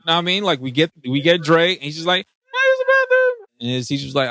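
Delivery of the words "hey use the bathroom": very high, drawn-out squeaky voice; slow falsetto imitation of a schoolboy; mock kid's request. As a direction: stretch it out way up high. Acting out the kid asking teacher.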